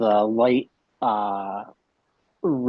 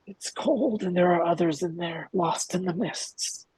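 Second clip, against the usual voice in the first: put-on higher, hollow boy's voice; eerie revived boy; 9 semitones higher than usual. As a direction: speak as a boy back from the cold mists. Revived boy tells his father.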